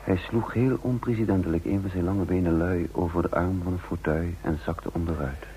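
measured, reflective storytelling voice; calm first-person narrator; story narration. A narrator's reading. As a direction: tell it looking back, unhurried. Narration between dialogue lines.

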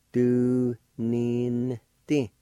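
slow teaching pronunciation; syllable by syllable. Shown as a demonstration. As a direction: separate syllables with short pauses. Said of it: "I'll pronounce these words".

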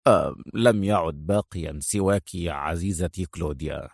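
low soft menace; very calm. Acted. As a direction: drop it quietly and coldly. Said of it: "في هدوء شديد".

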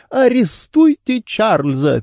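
high, drawn-out urgent demand; interrupting. A narrator's reading. Wife breaks in to demand brother-in-law's arrest, interrupting urgently. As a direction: cut in urgently, pitch well up. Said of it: "перебила его Делайн".

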